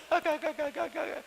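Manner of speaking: high, cackling mock laugh; put-on cackle; imitated laugh. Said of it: "Ele deu uma risada".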